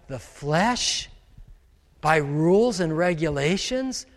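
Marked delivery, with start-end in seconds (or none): none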